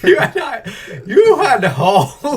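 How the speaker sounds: high, loud words through laughter; incredulous laughing. Real, not acted. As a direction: crack up in disbelief, stretching the words. Cracking up at the review.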